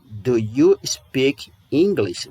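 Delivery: "Do you speak English?" again slowly, in an English voice; slow repeat. Shown as a demonstration. Teacher repeats the question slowly in English-sounding voice. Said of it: "Por exemplo".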